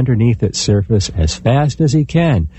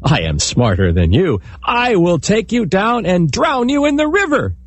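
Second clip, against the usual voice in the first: smug; 9 semitones higher than usual. A narrator's reading. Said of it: "And the man said".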